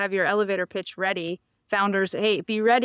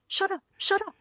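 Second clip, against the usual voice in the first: high, frantic self-scolding; imitated exasperated inner voice; mock inner scream; 11 semitones higher than usual. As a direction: berate yourself in comic panic. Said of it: "I'm screaming at myself".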